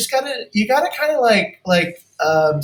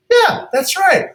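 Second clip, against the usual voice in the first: imitated client; 12 semitones higher, 4 dB louder, slower than usual. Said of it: "They'll say, like".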